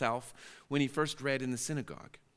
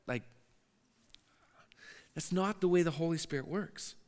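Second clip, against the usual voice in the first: usual speaking voice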